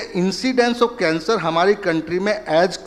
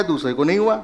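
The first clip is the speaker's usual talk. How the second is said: ordinary delivery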